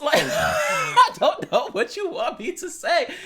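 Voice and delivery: high, loud talk through laughter; helpless, high-pitched amused protest; laughing. Real, not acted. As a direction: crack up mid-sentence. Cracks up after own reply.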